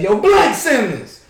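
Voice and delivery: loud, high, drawn-out call; acted call to a teammate. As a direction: holler the name slowly down the bench. Acts calling out to a teammate.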